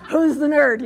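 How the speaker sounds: mock her like a teenager; mocking teen jeer.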